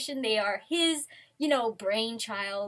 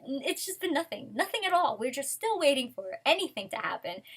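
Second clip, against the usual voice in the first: ordinary delivery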